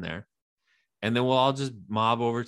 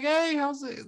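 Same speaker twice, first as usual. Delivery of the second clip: mock casual greeting; 12 semitones higher than usual.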